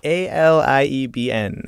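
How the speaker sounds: slow letters A L I E B N, each letter said apart; spelled out letters. Shown as a demonstration. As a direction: spell out A L I E B N slowly.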